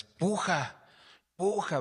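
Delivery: high urgent calls of push, hammed up a bit; mock doctor crying push in the delivery room; retold shout to push. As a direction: call out like the doctor urging her on. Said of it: "dice que le gritaba".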